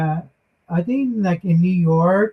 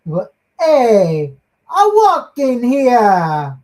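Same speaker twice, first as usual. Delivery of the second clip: loud, high, drawn-out New Yorker's yell; New York accent; 6 semitones higher, 6 dB louder, slower than usual. Retold or imitated. Recalling what New Yorkers say, loud New York accent. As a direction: shout it like an indignant New Yorker, stretched out. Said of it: "I don't know, they say".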